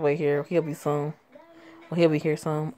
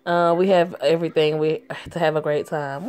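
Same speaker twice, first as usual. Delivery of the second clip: ordinary talk, nothing marked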